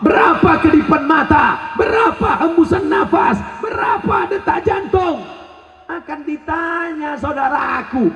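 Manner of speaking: high, forceful preaching build; fiery preaching. Acted. Preacher warning the crowd, full force. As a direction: preach hard and rising.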